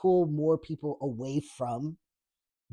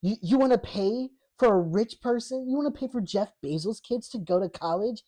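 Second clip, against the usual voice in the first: mocking liberal voice; 6 semitones higher, 4 dB louder than usual. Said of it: "they're like".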